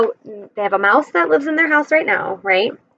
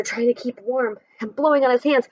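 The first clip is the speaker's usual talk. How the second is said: ordinary delivery, nothing marked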